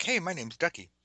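lightly voiced friend proudly introducing himself. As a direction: present yourself like the nickname is an honor. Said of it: "he's like".

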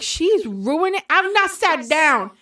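high-pitched mock complaint; exaggerated, high protest; mock upset. Retells own upset reaction to clip.

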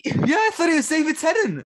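high, loud, eager exclamation; excited confirmation. Real, not acted. Excited confirmation of shared mistake.

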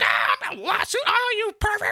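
outraged shriek. Retold or imitated. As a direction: shriek it up high, scandalized. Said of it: "it'd be like".

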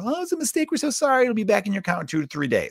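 very high-pitched, put-on customer-service apology; mock apologetic staff. Hotel staff apologise for the charge, mocking high voice. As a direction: mimic a sorry clerk in a squeaky high voice.

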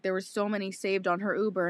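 ordinary delivery

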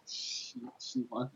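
fading into a whisper; trailing whisper. Real, not acted. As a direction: whisper the word away, unsure. Hesitant trailing off about liking job.

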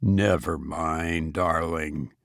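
husband soothes his weeping wife, tender and hushed; tender comfort. A narrator's reading. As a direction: gently comfort her, quietly. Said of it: "I said".

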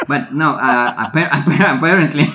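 laughing through the word. Real, not acted. Laughing restart.

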